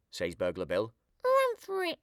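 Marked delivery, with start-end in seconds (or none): 1.2-2.0 put-on very high squeaky toddler; a baby parroting the command. A narrator's reading. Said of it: "says the baby".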